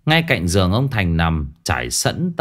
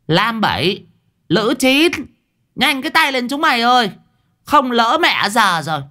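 high shrill yell; 13 semitones higher than usual. Acted. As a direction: bark the order, fast and high. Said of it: "quát".

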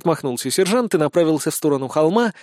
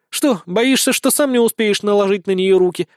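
high, mocking question; smirking taunt; 6 semitones higher, 4 dB louder than usual. Blonde teases the eager sergeant, mocking. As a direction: needle him with a sneer. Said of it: "усмехнулась Безос".

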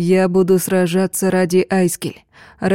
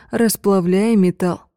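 plain reading voice

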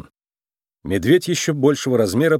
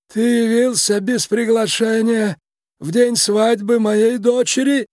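slow, high, creaky voice with authority; a tiny boss's imperious creak, drawn out; creaky, commanding Mister Big; 11 semitones higher than usual. A narrator's reading. Mob boss confronts the uninvited guest on his daughter's wedding day, creaky and commanding. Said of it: "Скрипучий голос мистера Бига звучал властно".

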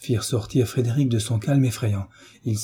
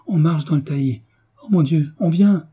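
rising alarm, higher and louder by the end; frightened; 6 semitones higher, 4 dB louder than usual. A narrator's reading. Mother hears someone coming through the copse, frightened. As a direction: warn in mounting fright. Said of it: "avec effroi".

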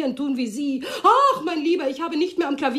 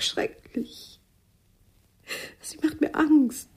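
breathy whisper; whispered confession; much slower than usual. Acted. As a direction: admit it under your breath. Confessing jealousy. (geflüstertes Geständnis)

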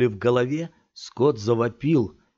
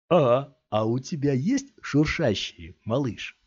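slow, higher and louder mocking drawl; teasing thug; 4 semitones higher, 3 dB louder, slower than usual. A narrator's reading. Thug in cap presses the boy for cash, taunting. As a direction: dangle the question like bait.

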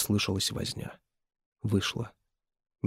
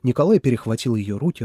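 usual speaking voice

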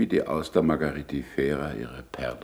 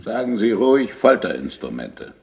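bitter retort; 6 semitones higher, 6 dB louder than usual. Acted. Galilei bitterly corrects the monk.

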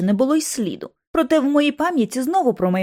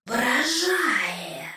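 soft, otherworldly sneer; the skull feigning admiration, hushed; ghost's mockery; 6 dB quieter than usual. A narrator's reading. Skull mocks Lucy. (тихий глузливий голос черепа)

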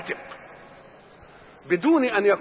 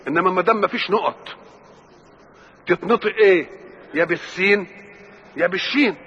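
ordinary talk

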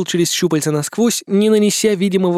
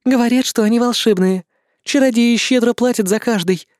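higher light female voice; demoness voice; 6 semitones higher than usual. Acted. Demoness explains the stones.

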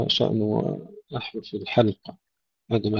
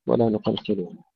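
normal talking voice

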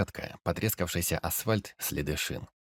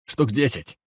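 high, loud alarmed shout; 5 semitones higher, 5 dB louder than usual. Acted. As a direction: call the numbers out in alarm. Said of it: "крикнул он".

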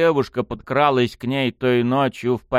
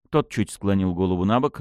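usual speaking voice

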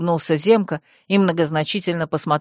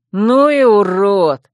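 loud, drawn-out jeer; jeering insult; 2 semitones higher, 6 dB louder, slower than usual. A narrator's reading. A youth insults Dep, loud drawling jeer. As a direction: throw the insult out slowly and loud.

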